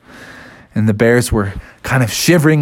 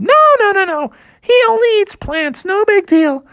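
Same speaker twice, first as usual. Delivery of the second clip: very high, squeaky dismissal; insistent little bear. Acted. Little bear reassuring the family, breezily insistent. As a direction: brush it off in falsetto.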